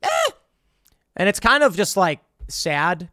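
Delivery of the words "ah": shrill high shrieking rant about gas; mock screaming woman. Mocking the viral woman screaming in her car, hammed-up shriek. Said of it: "this woman in her car screaming".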